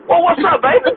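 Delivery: put-on high, playful "what's up, baby"; teasing slang greeting. Needling a coworker who hates being called baby, sung high. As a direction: tease the coworker with it. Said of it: "I'm not your baby".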